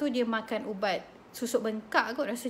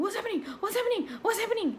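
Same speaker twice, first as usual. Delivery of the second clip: mock panic; 9 semitones higher, faster than usual. Panicked boy lying down.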